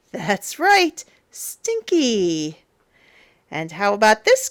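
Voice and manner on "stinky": ordinary delivery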